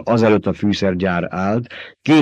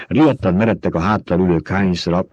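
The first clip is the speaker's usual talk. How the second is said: ordinary delivery, nothing marked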